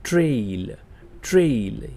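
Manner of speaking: teacher modelling ટ્રેઇલ as trail for students; model pronunciation. Shown as a demonstration. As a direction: clear teacher demo of the English word ટ્રેઇલ said as trail. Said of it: "યાદ રાખજો".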